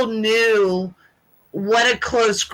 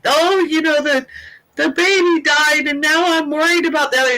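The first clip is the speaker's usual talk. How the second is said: retold bawling; 7 semitones higher than usual. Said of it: "I'm sitting there bawling".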